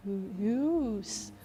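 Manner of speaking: "use" sounded out with a Y glide before the OO; dictionary pronunciation. Shown as a demonstration. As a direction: draw out "Ooh, use" as YOOS, testing it. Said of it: "I don't think I pronounce it that way".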